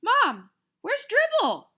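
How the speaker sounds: loud, high, drawn-out call to Mom; panicked boy hollering for his mother. Acted. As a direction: holler it across the apartment.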